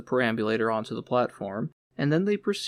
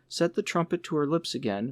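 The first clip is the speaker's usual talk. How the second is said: plain reading voice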